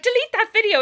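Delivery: high insistent order; imitating the angry girl; mock demanding girl. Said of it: "And she was like".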